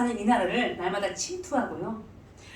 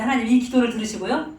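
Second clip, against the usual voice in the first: ordinary talk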